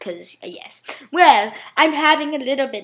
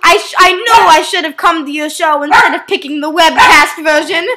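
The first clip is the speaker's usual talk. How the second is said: hammed-up excitement; 3 semitones higher, 9 dB louder than usual. Character bursts in theatrically.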